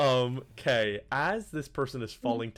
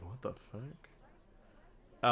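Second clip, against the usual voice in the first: whispered crowd murmur; 10 dB quieter, much slower than usual. Acted. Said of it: "talking around you like".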